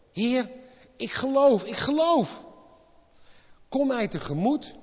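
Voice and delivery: loud, high cry of faith. Acted. Father crying out to Jesus that he believes, loud and high. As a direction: shout it to the Lord with all your heart.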